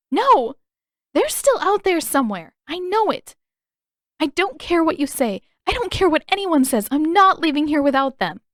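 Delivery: high, loud tearful defiance; defiant crying. A narrator's reading. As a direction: refuse through tears, fierce. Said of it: "she glared in response".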